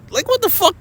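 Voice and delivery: outraged. Real, not acted. Outraged rant at officials.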